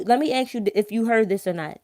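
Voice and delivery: ordinary delivery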